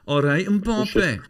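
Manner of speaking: put-on slow, high, loud Scottish-accented "all right, Mbappe". Greeting Jack on the phone in a Scottish accent, high and slow. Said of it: "I find it difficult not to say".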